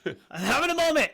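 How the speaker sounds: high, fast indignant protest; mock indignant. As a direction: shoo him off in a high rush. Retorting at the guard.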